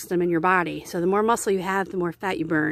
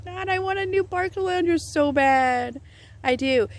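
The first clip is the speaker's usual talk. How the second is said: whiny longing; 10 semitones higher than usual. Real, not acted. Longing for a chair.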